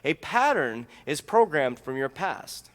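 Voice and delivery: slowed with "pattern" leaned on, each word laid down separately; "A pattern is programmed from your past." said word by word; slow deliberate repeat. Shown as a demonstration. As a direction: spell it out slowly so it sinks in. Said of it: "I'll say that again".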